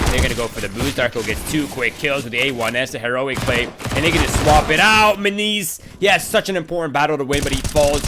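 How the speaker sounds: loud, rising esports call; live play-by-play. Real, not acted. Caster calls the heroic play to viewers, excited play-by-play. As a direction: build the call to a loud high peak.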